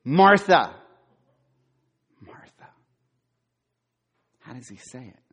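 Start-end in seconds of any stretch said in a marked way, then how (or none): 0.0-2.7 loud, sharp Martha, Martha; stern call. Shown as a demonstration. Pastor voicing Jesus scolding Martha, loud stern call. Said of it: "Or is it".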